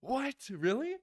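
high, loud disbelieving What? Really?; surprised. Real, not acted. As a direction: shoot up in disbelief. Shocked friend already disappointed.

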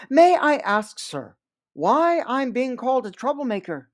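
ordinary delivery, nothing marked

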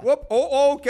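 high, loud startled yelp; jolted by a near fall. Real, not acted. Nearly falls while jumping.

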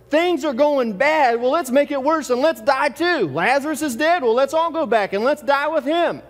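preacher mocking Thomas's gloomy sarcasm; sarcastic paraphrase. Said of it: "Thomas is being a little bit sarcastic".

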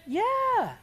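high, chirpy mock answer; mock eager yeah. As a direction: toss off a glib high yeah. Mocking a churchgoer's glib answer.

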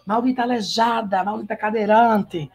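high-pitched, hammed-up soap-opera cursing; mock outrage, each insult thrown out high; Soraya's insults. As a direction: imitate a telenovela villainess berating a rival. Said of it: "E chama ela de".

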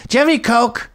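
put-on a drunk guest demanding drinks from the host; mock drunk demand. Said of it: "Then she goes".

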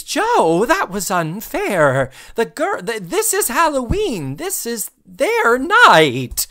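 high, drawn-out, eerie sweet reproach; creepy scolding. Retold or imitated. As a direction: chide him slowly in an unsettling singsong. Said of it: "so oddly, so creepily, says".

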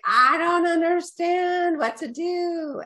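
high, slow despairing whine; a slow, high mock-cry of frustration; frustrated whine. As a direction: wail it out, at wit's end. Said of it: "I was crying and frustrated".

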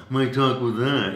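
cartoon big-jaw character voice; big-jawed guy. Shown as a demonstration. Actor demonstrating cartoon characters, big-jawed voice. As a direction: talk as if your jaw is huge. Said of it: "a guy with a big jaw".

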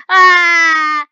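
loud, high "ah" scream; sudden scream. Real, not acted. Player driving straight ahead, sudden scream. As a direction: yell out a sustained high cry.

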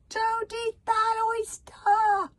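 high, drawn-out, booming yell; shouted warning. A narrator's reading. Bodyguard stops the chief eating an oyster, shouting. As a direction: bellow it slowly, stretching every word. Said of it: "Humongous shouted out".

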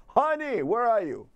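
calling honey. Pretend. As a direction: call out for your partner from the couch. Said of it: "speaking of honey".

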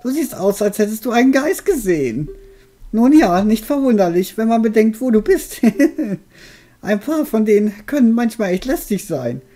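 put-on high, cackling old witch. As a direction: play the friendly old witch. Voices the witch character.

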